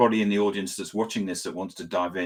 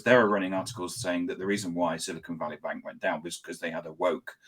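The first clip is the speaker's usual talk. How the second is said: normal talking voice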